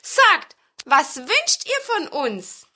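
hohe, laute, gedehnte Frage; rufende Zwerge. A narrator's reading. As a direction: frag laut rufend mit hoher Zwergenstimme. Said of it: "riefen die Zwerge". (gedehnter Zwergenruf)

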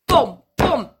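two loud spoken thuds; knocking imitation. A narrator's reading. Narrator imitates knocks at the old door, loud booming knocks. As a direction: boom out two heavy knocks. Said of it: "Da klopfte es auf einmal".

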